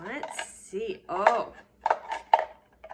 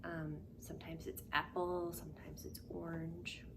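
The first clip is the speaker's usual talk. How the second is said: whisper; 3 semitones lower, 7 dB quieter than usual. Pretend. Whispered clue.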